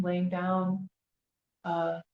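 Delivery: ordinary delivery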